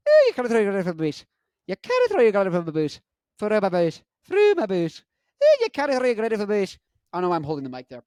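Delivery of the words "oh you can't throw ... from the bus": put-on high, loud, rapid Scottish accent; Scottish relative. Relative in Scotland scolding, fast thick Scottish voice. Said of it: "I did all these accents".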